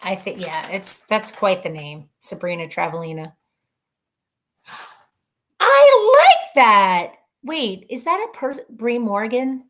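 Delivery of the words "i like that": gasp, then a high, loud, slow I like that; thrilled by the idea; excited high exclamation; delighted gasp. Real, not acted. Gasps at a name suggestion.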